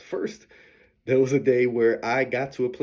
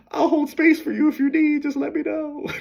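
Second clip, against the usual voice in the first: high, louder mock mom voice; imitating mom; 6 dB louder than usual. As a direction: squeak it out like an eager mother. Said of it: "she called me saying".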